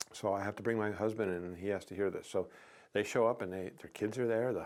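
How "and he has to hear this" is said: usual speaking voice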